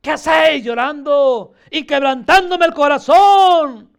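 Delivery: loud, shouted reproach; impassioned acted shout; forceful rebuke. Shown as a demonstration. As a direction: cry it out to scold the weepers.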